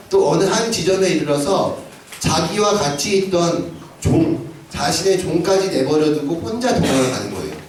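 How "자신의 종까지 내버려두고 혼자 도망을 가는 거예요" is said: ordinary delivery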